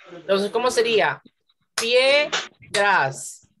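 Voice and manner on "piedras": PIEDRAS as PIE, pause, DRAS; two separate syllables. Shown as a demonstration. Teacher asking how it would divide, two separate syllables. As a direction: keep pie together, then a separate dras. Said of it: "solo tiene dos".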